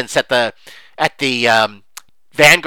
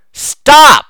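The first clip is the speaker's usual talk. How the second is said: shouted stop; 11 semitones higher, 10 dB louder than usual. Real, not acted. Shouted command to forum posters.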